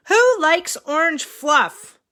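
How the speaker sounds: high, loud and slow, eager; excited question. Acted. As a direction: ask the kids with big excitement. Asking child excitedly.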